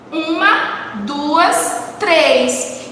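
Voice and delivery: slow, spaced count. Shown as a demonstration. Teacher showing how to count syllables, slow deliberate count. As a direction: count each one off slowly and separately. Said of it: "eu conto".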